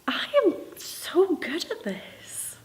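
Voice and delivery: high, breathy half-whisper, drawn out; self-congratulating thought. Acted. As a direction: gush it slowly to yourself under your breath. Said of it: "thinking to myself".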